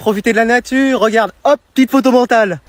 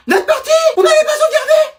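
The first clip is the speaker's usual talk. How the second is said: anguished scream. Acted. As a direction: shriek over the lost save. Kids lose unsaved game.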